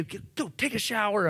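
put-on yelling at kids. Grumpy dad yelling at the kids to shower, mild mock yell. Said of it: "I'm yelling at the kids".

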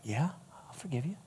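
barely audible, very soft reply; tiny, hushed retold voice; hesitant forgiveness. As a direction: murmur it as the forgiving girl. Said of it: "her look on her face is like".

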